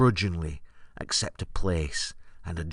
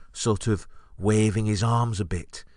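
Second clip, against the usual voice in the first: ordinary talk, nothing marked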